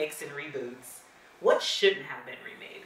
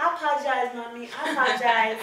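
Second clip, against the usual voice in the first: raised childlike voice imitating her toddler; child imitation; 5 semitones higher, faster than usual. Mom imitating her daughter Halo, in a higher childlike voice. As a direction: play little Halo saying sorry sweetly. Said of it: "And she".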